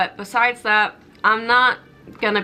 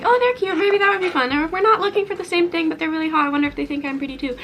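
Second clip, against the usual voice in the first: airy much higher voice, quickening to the end; shallow swiper; 7 semitones higher, faster than usual. Retold or imitated. Mocking careless app swipers in a high airy voice. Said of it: "not like".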